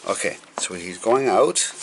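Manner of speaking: ordinary delivery, nothing marked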